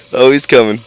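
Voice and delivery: normal talking voice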